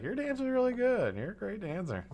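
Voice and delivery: put-on high, sugary praise for a child; sweet encouraging voice. Dad cheering on his eight-year-old daughter, high and sweet. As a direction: gush over her dancing like a proud parent. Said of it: "I'd be like".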